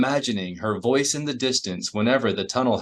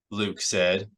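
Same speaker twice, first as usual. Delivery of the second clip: ordinary delivery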